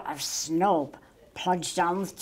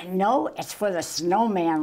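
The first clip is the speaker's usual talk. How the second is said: usual speaking voice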